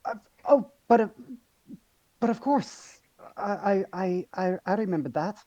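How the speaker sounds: put-on high, stammering Icelandic-accented warrior; a flustered Varg in a shaky Icelandic accent. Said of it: "more Transylvanian than Icelandic".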